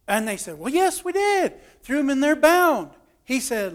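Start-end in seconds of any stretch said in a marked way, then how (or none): 0.6-2.9 higher-pitched confirming voice; acted eager reply. Storyteller as servants answering the king, high-pitched reply. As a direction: answer up high, as if it were obvious.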